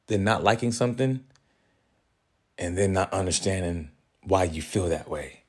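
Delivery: normal talking voice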